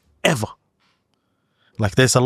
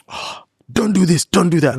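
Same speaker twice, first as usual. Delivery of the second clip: a higher, mildly scolding parent voice; mock nagging parent; 9 semitones higher than usual. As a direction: lightly imitate a fussy nagging parent. Mocking nagging strict parents.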